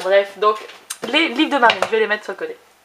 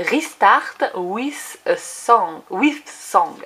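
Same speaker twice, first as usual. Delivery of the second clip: slow, deliberate French-accented English; English title sounded out slowly with a French accent; self-mocked English accent; much slower than usual. Shown as a demonstration. Vlogger re-reading a book title in her "super" English accent. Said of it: "avec mon super accent anglais".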